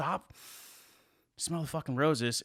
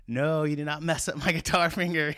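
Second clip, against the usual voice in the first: loud, raised-voice outburst, re-enacted; retold yelling; 3 semitones higher, 5 dB louder than usual. Retelling the smashed guitar finger, re-enacted yelling. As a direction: act out yelling at the injury. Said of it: "I was, like, yelling".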